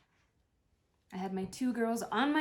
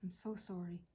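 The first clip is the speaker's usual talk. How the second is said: soft apology; 3 semitones lower, 9 dB quieter than usual. Real, not acted. Quiet apology to dying mother.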